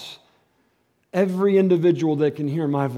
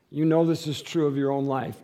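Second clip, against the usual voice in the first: normal talking voice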